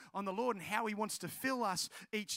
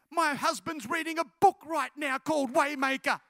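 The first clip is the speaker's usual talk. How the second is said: louder, insistent retold exclamation; thrilled, raised-voice reveal; astonished excitement; 3 semitones higher, 6 dB louder than usual. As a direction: exclaim it like an unbelievable coincidence. Girl astonished by further coincidence.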